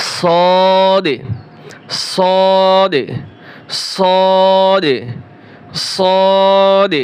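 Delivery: সদ and দদ held long and heavy; drawn-out letters. Shown as a demonstration. Teacher drilling students on long সদ and দদ. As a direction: pull each letter for three alif beats. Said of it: "এটাও তিন আলিফ করে টান হবে।".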